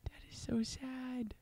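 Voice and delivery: soft, breathless whisper. Real, not acted. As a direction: breathe the words out with no voice.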